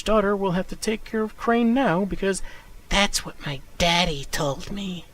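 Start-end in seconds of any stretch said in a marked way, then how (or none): 2.9-5.1 put-on slow, childish creepy voice; sing-song like an eerie child; creepy little girl voice. Joker's daughter quoted.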